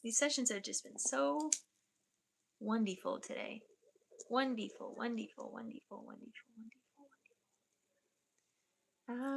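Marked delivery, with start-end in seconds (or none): 4.3-9.4 fading to a whisper. Acted. Savoring the finished session, trailing off into a whisper. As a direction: repeat it, fading from soft speech into a whisper.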